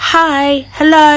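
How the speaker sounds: slow, drawn-out Hi Hello; textbook greeting. Shown as a demonstration. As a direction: stretch out Hi Hello like a school drill. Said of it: "ทักทายชาวบ้านเรียนมาตลอด". (ทักทายแบบท่องจากตำรา ลากเสียงช้า)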